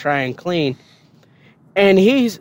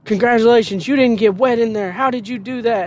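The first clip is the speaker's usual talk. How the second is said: sarcastic drill sergeant; 7 semitones higher than usual. Retold or imitated.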